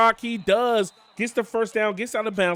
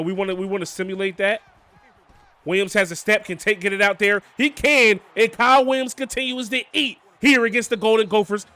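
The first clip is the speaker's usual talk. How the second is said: ordinary talk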